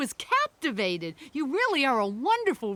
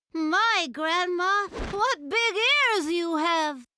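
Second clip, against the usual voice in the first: put-on high, drawn-out girl's voice; storybook little girl, high and slow; 7 semitones higher, much slower than usual. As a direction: marvel slowly, pitched well up.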